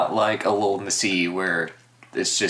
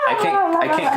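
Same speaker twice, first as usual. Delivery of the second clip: much higher squeaky Elmo impression; 4 dB louder, faster than usual. Shown as a demonstration. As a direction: chirp it like Elmo, way up high. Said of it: "I tried to do an Elmo underwater voice".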